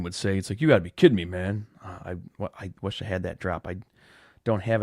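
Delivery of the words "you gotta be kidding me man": a loud, hammed-up catchphrase, leaning hard on "kidding"; lightly imitating a fellow YouTuber's signature line, much louder; Phil Shurkin catchphrase. Vlogger quoting a YouTuber's catchphrase to viewers, loud mock impression. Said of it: "the great and the one and only Phil Shurkin would say".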